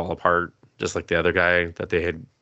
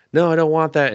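higher, louder flat-out no; firm refusal; 9 semitones higher, 6 dB louder than usual. Retold or imitated. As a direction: shut the offer down hard. She refuses the hero killer sword.

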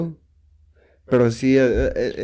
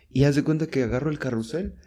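ordinary talk, nothing marked

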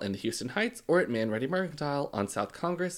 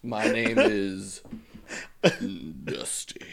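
slow smoky introduction sinking to a whisper; slow, husky late-night self-introduction; drag queen persona; 3 semitones lower, 3 dB louder, slower than usual. Pretend. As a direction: draw out the name like a tired drag queen. Playing worn-out drag queen introduction.

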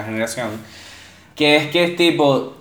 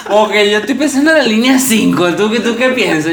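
higher, louder, laughing mock answer; laughing mock reply; 7 semitones higher, 4 dB louder than usual. A friend answering a sad text, laughing. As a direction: blurt it through laughter as the bad friend. Acting a friend's joking suicide plan.

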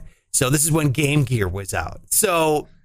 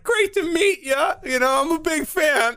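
Rob Schneider impression; 13 semitones higher than usual. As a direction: ham up a starstruck celebrity greeting. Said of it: "he's like".

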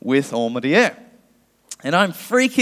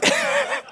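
squeaky laugh; 3 dB quieter than usual. Real, not acted. Laughs at friend crammed in back.

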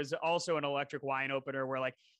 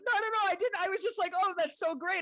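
much higher, mock-flustered denial; defensive protest; 13 semitones higher than usual. Guy insists to the room he wasn't being rude, flustered. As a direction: imitate him backpedalling, mock panic. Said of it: "And he's like".

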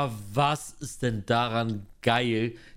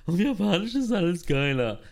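mock-enthusiastic, higher voice; mocking quote; 6 semitones higher, 3 dB louder than usual. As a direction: parody the fans' gushing. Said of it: "die Leute, die das sagen". (spöttisch nachgeäfft, höher)

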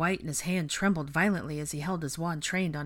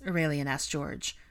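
ordinary delivery, nothing marked

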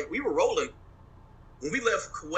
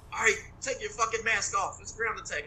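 higher-pitched mock order, lightly voiced; a hint of a barking major; 9 semitones higher than usual. To the host, retelling the major's order: lightly voiced command. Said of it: "our major's like".

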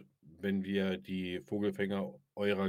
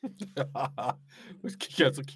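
laughing; 13 semitones higher, much slower than usual. Real, not acted. Laughing at witch.

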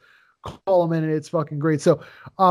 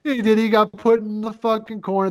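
ordinary delivery

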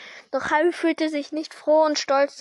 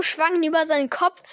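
usual speaking voice

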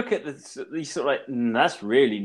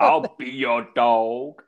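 put-on slow drawled southern US accent; 3 semitones lower, 5 dB louder, much slower than usual. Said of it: "let's say, southern accent".

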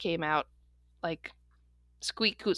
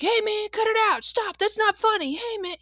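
high-pitched imitation of an upset kid; distressed; 12 semitones higher than usual. Kid outside pleading with a bigger kid, imitated distress. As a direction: act out a kid protesting in distress. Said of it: "actual distress".

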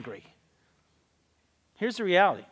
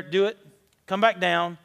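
ordinary talk, nothing marked

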